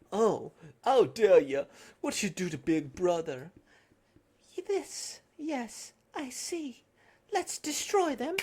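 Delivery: ordinary talk, nothing marked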